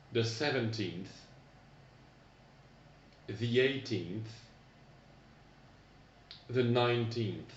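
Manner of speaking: teacher demo, pausing for the class to repeat; "the thirteenth" said clearly with its final th; ordinal teens modelled. Shown as a demonstration. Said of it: "répétez après moi".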